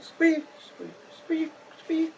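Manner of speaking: very high squeak imitation; squeaky hides.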